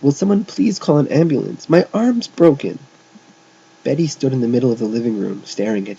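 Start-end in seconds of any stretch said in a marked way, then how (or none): 0.0-2.8 high, pleading moan; beginning to blubber. A narrator's reading. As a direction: plead for help, near blubbering. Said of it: "beginning to blubber".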